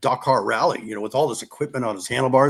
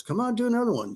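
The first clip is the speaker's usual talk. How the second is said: lightly voiced, higher coaxing push; 10 semitones higher, 4 dB quieter, faster than usual. The guy urging Tom to ride another one, light higher coaxing. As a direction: nudge him to go again, pitched up. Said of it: "so then the guy goes".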